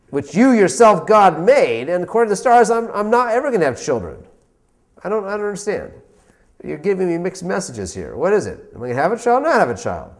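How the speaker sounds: Abraham protesting to God, baffled and worked up; exasperated Abraham. Retold or imitated. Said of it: "And Abraham says".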